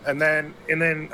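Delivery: ordinary talk, nothing marked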